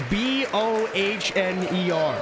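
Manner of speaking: letters spelled slowly one by one; spelling it out. Shown as a demonstration. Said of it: "that's not how you pronounce".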